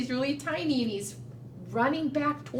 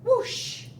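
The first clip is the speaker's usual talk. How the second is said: breathy wind whoosh, high; 11 semitones higher than usual. Acted. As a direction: blow it out like the wind. Storybook wind sound effect.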